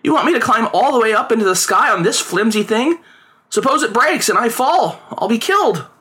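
put-on high, alarmed child's objection; protesting boy. A narrator's reading. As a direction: argue against it in a high boy's voice. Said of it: "he protested".

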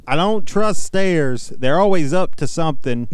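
slow, drawn-out cowboy joke delivery. Acted. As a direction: drawl it like a cowboy telling a joke. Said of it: "do it in a cowboy voice".

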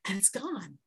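soft unvoiced whisper. Real, not acted. Teacher tells the class her sorrow is gone, whispering. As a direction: let it vanish in a whisper. Misery vanishes from her face.

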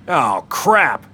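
startled yell. A narrator's reading. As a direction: shout it out in alarm. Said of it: "Marlon yelled".

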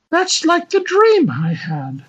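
high, dreamy lady; high woman voice. Acted. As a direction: muse like a lady.

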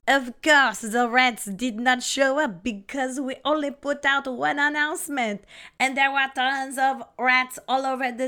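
hammed-up French accent. French lawyer defending rats before the court, French accent. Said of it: "giving him a French accent".

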